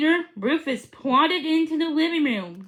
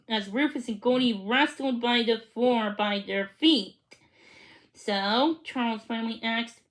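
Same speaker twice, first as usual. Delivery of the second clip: usual speaking voice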